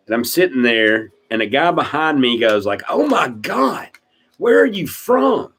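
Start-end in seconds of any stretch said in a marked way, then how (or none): 2.9-5.5 mock astonishment, pitched way up; astonished stranger. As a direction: imitate a high-pitched, incredulous stranger. Retold stranger astonished at his accent.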